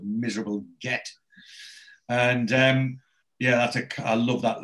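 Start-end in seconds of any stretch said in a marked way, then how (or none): 0.0-1.1 muttered insult. Retold or imitated. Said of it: "he pauses and he goes".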